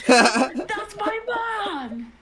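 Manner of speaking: mock protest. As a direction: object loudly in mock outrage. Protesting joke about mom.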